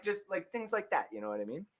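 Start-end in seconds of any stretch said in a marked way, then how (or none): none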